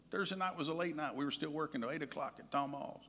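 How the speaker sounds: ordinary talk, nothing marked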